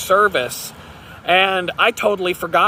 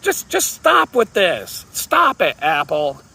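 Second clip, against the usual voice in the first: exasperated; 3 semitones higher, slower than usual. Real, not acted. Fed up with Apple.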